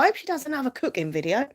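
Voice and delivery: put-on Cockney voice; Cockney accent. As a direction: quote him in extra Cockney. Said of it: "give him an extra Cockney accent".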